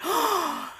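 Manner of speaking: quick high gasp of recognition; sharp gasp. Retold or imitated. Preacher acting Peter seeing Jesus, sharp gasp. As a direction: gasp as if you just spotted him.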